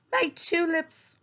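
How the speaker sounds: high, drawn-out wail without shouting; dismayed cry. A narrator's reading. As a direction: cry out over your ruined flowers. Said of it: "she cried".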